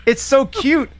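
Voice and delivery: usual speaking voice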